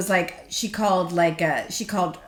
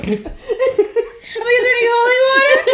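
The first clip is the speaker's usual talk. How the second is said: laughing panic; 11 semitones higher, faster than usual. Retold or imitated. Guest in a basement full of saints, laughing in mock panic. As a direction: squeal it through laughter.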